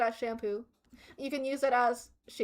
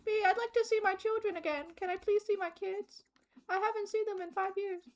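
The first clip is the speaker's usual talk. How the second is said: pleading hostage voice; 5 semitones higher, 7 dB quieter than usual. Pretend. Voicing hostage viewers.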